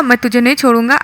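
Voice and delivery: ordinary talk, nothing marked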